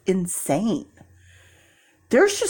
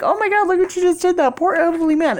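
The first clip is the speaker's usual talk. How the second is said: imitating shocked onlookers; mock outraged crowd; 9 semitones higher, faster than usual. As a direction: play horrified bystanders. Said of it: "everyone would be like".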